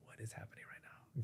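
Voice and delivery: barely voiced, hushed and baffled; whispered disbelief. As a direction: breathe the question under your breath. Bewildered inner thought, whispered.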